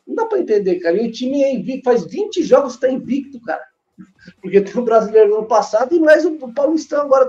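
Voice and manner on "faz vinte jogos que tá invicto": ordinary talk, nothing marked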